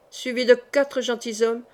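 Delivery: ordinary delivery, nothing marked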